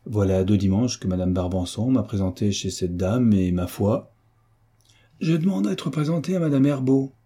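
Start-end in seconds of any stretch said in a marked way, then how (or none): 5.2-7.1 high-pitched eager outburst; exclaimed interruption. A narrator's reading. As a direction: break in eagerly, pitch well up. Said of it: "s'écria le jeune duc en interrompant". (exclamation enthousiaste qui coupe la parole)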